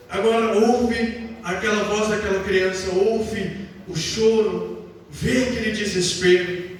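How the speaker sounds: deep, slow, solemn voice; booming male voice. Acted. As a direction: sink low like a great presence. God hearing the boy's cry.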